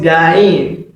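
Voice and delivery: "gain" stretched slowly as ga-yin; common mispronunciation. Retold or imitated. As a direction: show how people wrongly say "gain". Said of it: "People call it gain".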